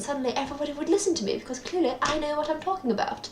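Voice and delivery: hammed-up posh voice, rattled off briskly; posh accent. Shown as a demonstration. Showing viewers how posh talk sounds smarter, mock posh accent. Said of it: "so sorry for that accent".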